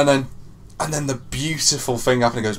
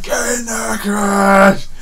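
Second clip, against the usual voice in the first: higher, choked-up triumphant "Get in there"; tearful cheer; 9 semitones higher, 7 dB louder, much slower than usual. Retold or imitated. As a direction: cheer through happy tears. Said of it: "I said".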